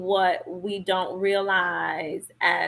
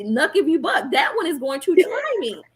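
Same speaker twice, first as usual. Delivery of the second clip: put-on higher, cheeky kid testing his mother; imitating a defiant child; 8 semitones higher than usual. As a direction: play the kid daring to push back. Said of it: "he's the one that's gonna be".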